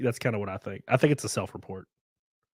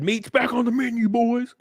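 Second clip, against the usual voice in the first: high, put-on orc growl of a movie line; orc quote voice; 12 semitones higher than usual. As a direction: ham up the orc war cry. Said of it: "and said".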